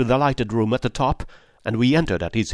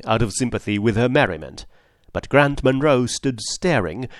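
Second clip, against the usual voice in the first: plain reading voice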